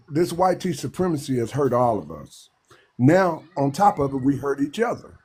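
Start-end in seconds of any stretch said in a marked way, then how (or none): none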